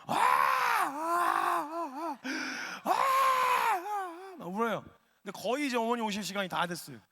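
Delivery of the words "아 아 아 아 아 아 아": high, long drawn-out comic bawl; mock wailing sob. Older sister bawling after being cursed, exaggerated wail. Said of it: "오열을 하기 시작하는 거예요".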